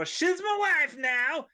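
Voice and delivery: high, slow catchphrase impression; drawn-out, high-pitched comic foreign character; Borat. Groom to the wedding crowd, Borat impression. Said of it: "Borat's timeless".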